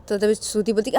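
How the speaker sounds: usual speaking voice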